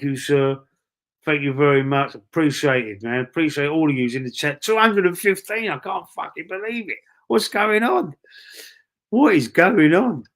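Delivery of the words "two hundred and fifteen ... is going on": higher, near-shouting amazement at the numbers; can't believe the count is still climbing; excited disbelief. Real, not acted. Record stream viewer count.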